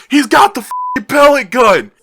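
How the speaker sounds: high, very loud panicked scream; screamed alarm. Retold or imitated. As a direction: scream a warning at the top of the voice. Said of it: "he just screams".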